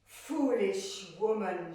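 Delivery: loud, slow rebuke; stern goddess. Acted. Demeter rebuking the queen, a loud, slow, stern scold. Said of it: "she said".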